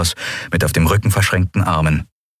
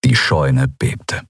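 usual speaking voice

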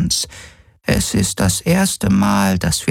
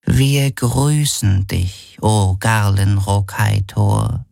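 put-on very slow, solemn old woman; drawn-out awe from a humble elder; reverent; 3 semitones higher, much slower than usual. A narrator's reading. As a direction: greet him as if he were a god. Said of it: "sagte sie ehrfürchtig".

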